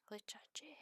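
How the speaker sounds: Recharger whispered in French, re-shar-zhay. Shown as a demonstration. Streamer repeats the French word for reload in a whisper. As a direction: breathe Recharger softly the French way. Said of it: "To say reload in French".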